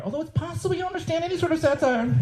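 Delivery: high, sneering aside; sarcastic jab. Retold or imitated.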